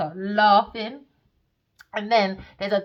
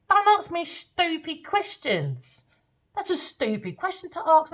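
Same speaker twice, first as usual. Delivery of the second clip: high, slow, scornful brush-off; dismissive; 8 semitones higher than usual. Retold or imitated. Telling the dream man off, high and scornful. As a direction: talk down to him.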